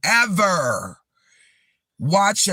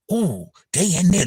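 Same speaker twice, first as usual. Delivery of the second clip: usual speaking voice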